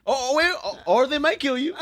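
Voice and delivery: high flustered dad voice; a dad correcting himself in a panic; panicky dad. Retold or imitated. Dad voiced in comedian bit.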